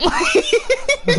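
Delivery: high breathless laughter; giddy laugh. Real, not acted. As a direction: laugh helplessly at the chaos. Laughing at the chaos.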